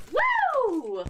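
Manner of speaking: high celebratory woo; excited whoop. Real, not acted. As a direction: whoop like at a party.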